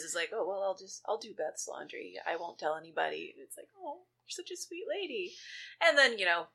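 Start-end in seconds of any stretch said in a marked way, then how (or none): 0.3-3.3 soft, kindly imitated reassurance; lightly acted sweet nanny; sweet old lady. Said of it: "being the sweet lady that she is". 3.8-5.3 high, soft, sweet coo; softly hammed-up affection; touched cooing. Cooing over the kind nanny.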